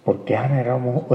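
ordinary talk, nothing marked

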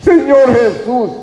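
high-pitched imploring cry. Retold or imitated. Blind beggar to passing Jesus, crying out. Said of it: "comenzó a dar voces".

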